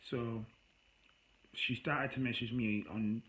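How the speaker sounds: usual speaking voice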